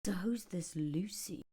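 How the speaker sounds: slow whispered question; whispered gossip. Retold or imitated. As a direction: breathe it like gossip.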